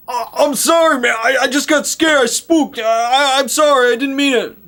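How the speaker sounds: high, loud frantic apology; panicked apology. Retold or imitated. Spooked gunman apologizing after shooting his companion. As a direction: blurt out sorry in a flustered rush. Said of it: "He's like".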